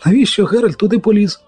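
high-pitched, dismayed question; gasped dismay. A narrator's reading. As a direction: moan it in alarm. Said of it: "охнув жовтець".

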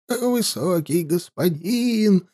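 put-on soft, slow quavering old voice. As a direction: plead in a trembling old voice. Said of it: "дребезжащим голосом".

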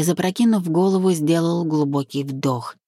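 plain reading voice

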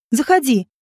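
ordinary delivery

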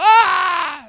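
high, loud movie scream; over-the-top mock scream of horror; exaggerated scream. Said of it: "Nossa, que exagero". (grito exagerado de filme)

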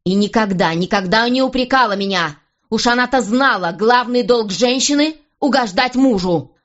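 rising pitch, insistent reproach without a full yell; angry retort. A narrator's reading. King holds up his first wife to Anna, angry retort. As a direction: build the reproach, stretching the final words. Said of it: "заорал он в ответ".

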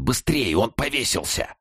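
high, soft, strained croak; hoarse urgency. A narrator's reading. Inmate reporting a hanging to cellmate, hoarse urgency. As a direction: urge him on in a hushed, panicked rasp. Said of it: "прохрипел он".

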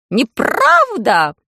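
loud, drawn-out crow cry; shouting crow. A narrator's reading. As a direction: shriek it as a panicked crow. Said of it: "закричала ворона".

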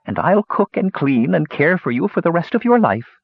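put-on high, scratchy old woman pleading; hurt, loving. A narrator's reading. Old woman pledging care to her captive, hurt and loving. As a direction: promise devotion in a shrill, wounded voice. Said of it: "her feelings hurt".

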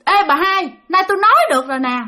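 high-pitched ghost calling out; high, eerie summons through a medium; possessed medium. Acted. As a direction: call out sharply as the spirit, pitched high. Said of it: "Nói vậy đó các bạn".

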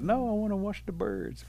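high-pitched little refusal; protesting turtle. Acted. Turtle turns down his playmates, high protest.

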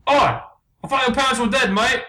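high put-on English mate; cartoon Englishman, pitched right up; unconvincing English accent. Blue asks about the dead parents, high English accent. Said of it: "very Englishmen".

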